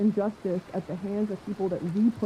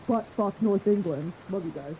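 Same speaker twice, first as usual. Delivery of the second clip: usual speaking voice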